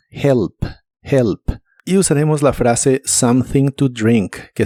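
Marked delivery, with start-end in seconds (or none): 0.1-1.4 "Help" with a soft breathy h like a light j; "help" con h suave; soft h demo. Shown as a demonstration. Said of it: "La H se pronuncia como una J suave".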